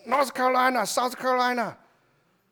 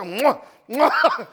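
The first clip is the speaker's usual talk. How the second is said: two loud smacking air kisses; playful mwah mwah kisses; mock kiss sounds; 3 semitones higher, 6 dB louder than usual. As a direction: imitate the sisters' cheek kisses, a bit louder. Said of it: "I have to make the same sound?".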